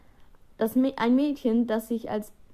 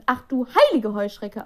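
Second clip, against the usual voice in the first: loud, slow astonished cry; girl exclaiming in shock at Helena; 2 semitones higher, 8 dB louder than usual. Acted. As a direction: call it out in disbelief, drawn out. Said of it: "und rief".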